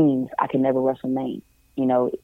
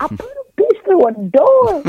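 much higher, laughing and slow; gleeful brag; 5 dB louder, slower than usual. Retold or imitated. Bragging to best friend Mike on the phone, laughing high. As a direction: laugh it out, proud and giddy.

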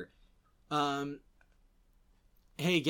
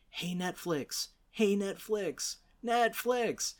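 higher, drawn-out mock hail; mock calling out; 5 semitones higher, slower than usual. Podcaster hails a streamer, mock calling out. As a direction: slowly call for someone's attention.

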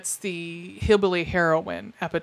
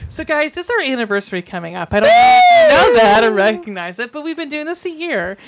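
excited announcement; 5 semitones higher, 8 dB louder than usual. Real, not acted. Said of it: "addresses audience excitedly".

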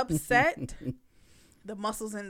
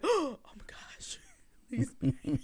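gasped, whispered mock alarm; scared whisper; 3 semitones higher, slower than usual. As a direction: whisper it slowly in mock fright. Acts out her scared inner reaction.

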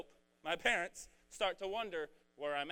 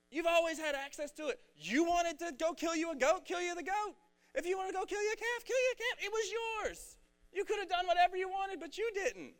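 much higher, louder fired-up father, lightly voiced; father's voice; 13 semitones higher, 5 dB louder than usual. Preacher voicing the father scolding his older son, high and pushy. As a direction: plead it out like an exasperated dad, bro. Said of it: "That's kinda how I think he talked".

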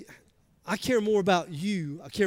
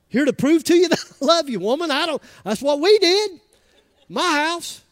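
mock shouting, much higher and louder; hammed-up holler; 9 semitones higher, 9 dB louder than usual. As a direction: holler it like a Southern husband proving love. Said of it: "we hollered at each other".

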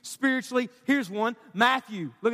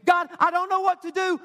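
helpless plea; 5 semitones higher, 3 dB louder than usual. Acted. Voices a helpless prayer to God.

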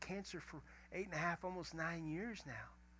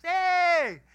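big, high-pitched holler; loud, drawn-out hey; shouted greeting; 18 dB louder than usual. Retold or imitated. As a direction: holler hello like a jolly old guy. Old friend calling out a greeting.

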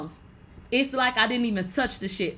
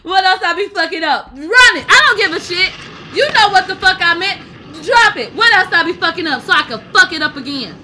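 loud, higher, laughing rant; laughing shout; 6 semitones higher, 10 dB louder than usual. Real, not acted. Yelling at chat through laughter, loud and high.

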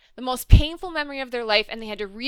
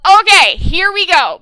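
loud, higher-pitched burst of readiness; hyped anticipation; 5 semitones higher, 11 dB louder than usual. Retold or imitated. Fan reliving her buildup to the season finale, hyped. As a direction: punch it out like bracing for a big show. Retelling own excited reaction to finale.

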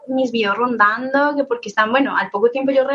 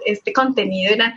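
ordinary talk, nothing marked

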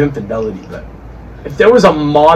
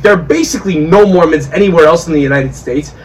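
normal talking voice

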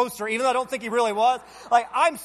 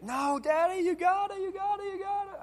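put-on high kid voice, rising higher and fading softer; little boy cheering; 10 semitones higher, 5 dB quieter than usual. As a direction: chant it like an eager child, trailing off. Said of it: "and Aiden".

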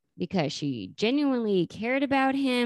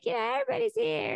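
much higher, quick mock Janice; imitating Janice; faster than usual. Janice greeting the gang, high quick mock voice. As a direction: chirp it fast and high like Janice. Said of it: "she's like".

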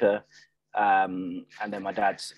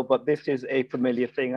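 ordinary delivery

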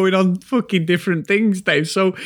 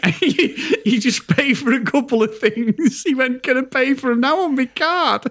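high voice breaking into laughter; laughing retelling; 8 semitones higher than usual. Retold or imitated. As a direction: imitate the plea while cracking up. Laughing while retelling mate's embarrassed plea.

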